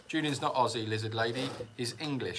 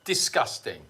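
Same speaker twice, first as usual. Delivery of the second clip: mock disgust; 4 semitones higher, 6 dB louder than usual. Mock refusal to answer a question.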